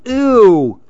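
disgusted ew. Acted. Lil imagining eating broccoli, disgusted Ew. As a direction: recoil at the thought of broccoli.

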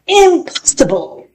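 high-pitched, dramatic dismissal; magician's haughty refusal. A narrator's reading. Magician refusing to change the dog back, high and dramatic.

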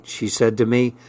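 usual speaking voice

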